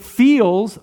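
"feels" drawn out slowly and emphasized; stress on feels. Real, not acted. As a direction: lean long on the word "feels". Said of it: "I'm gonna emphasize that word feels".

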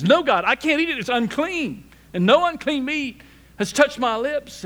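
loud, high-pitched refusal as Peter; resisting protest. Retold or imitated. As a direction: push back hard, voice up and loud. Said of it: "Peter resists that and says".

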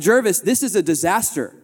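hammed-up disaster alarm; retold panic. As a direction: act out the night-before panic to a friend. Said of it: "I said".

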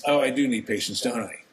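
usual speaking voice

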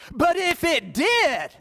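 high, drawn-out and emphatic; emphatic build-up. Shown as a demonstration. Preacher hammering a repeated point, high and emphatic. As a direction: stretch it out high with rising force.

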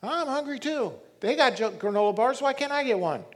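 put-on high, whining child's voice; whiny kid. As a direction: plead like a jealous little boy. Son whining for a bar.

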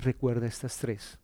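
normal talking voice

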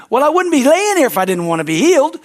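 high, loud, snarky retort; sarcastic cripple. Retold or imitated. Man at the pool answering Jesus, high and sarcastic. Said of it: "That's a dumb question".

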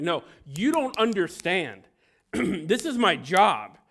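a reporter losing his temper at the gate; mock outrage, This is my job dragged out; angry reporter. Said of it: "the news reporter is starting to get very angry".

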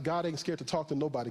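normal talking voice

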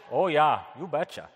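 hammed-up Midwestern mother-in-law; playful Midwestern accent; Wisconsin accent. Shown as a demonstration. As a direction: put on a broad Wisconsin "oh yah". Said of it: "how she would say this".

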